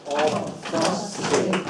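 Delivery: slow, chanted beat on each syllable of "obfuscated"; one syllable per beat; rhythmic syllables. Shown as a demonstration. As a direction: say "obfuscated" in time with claps. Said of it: "say it with rhythm".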